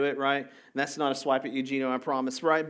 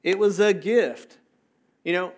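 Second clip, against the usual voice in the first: ordinary talk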